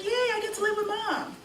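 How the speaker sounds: put-on high, little-girl cheer; child's glee. Little girl happy about custody, imitated glee.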